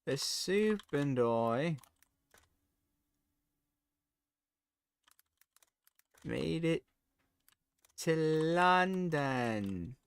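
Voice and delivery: slow, drawn-out put-on British voice; posh-ish mock Brit announcing arrival. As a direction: stretch it like a proud tourist. Rehearsing caption in British voice.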